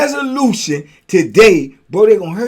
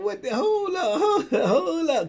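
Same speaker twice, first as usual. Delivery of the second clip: higher, slowed hold up pleading; alarmed protest; 9 semitones higher, 4 dB quieter, slower than usual. Retold or imitated. Storyteller stopping the youngsters, high and alarmed. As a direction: stretch out the hold ups in alarm. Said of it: "I'm like".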